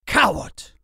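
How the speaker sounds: loud, high burst of contempt; angry, scornful outburst; spat insult. A narrator's reading. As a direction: spit it out as an accusation. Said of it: "he spat".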